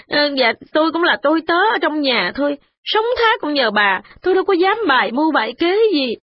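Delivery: tearful pleading. A narrator's reading. The servant begging the matron for mercy, mild tearful plea. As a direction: beg the mistress, close to tears. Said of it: "vừa khóc vừa nói".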